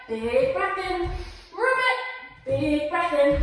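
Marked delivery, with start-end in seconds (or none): 1.6-2.0 high, loud, bright ribbit; frog croak. Acted. Teacher croaks like a frog for the kids.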